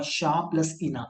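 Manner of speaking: शा प्लस इना, slowly split into two parts; separated vowels. Shown as a demonstration. Teacher explaining the French name's sounds, separated vowels. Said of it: "French में भी तो शाइना बोलूंगा".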